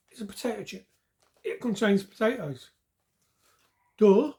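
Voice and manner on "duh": loud, mocking duh; sarcastic duh. Real, not acted. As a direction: scoff at the obvious. Mocking the obvious potato warning.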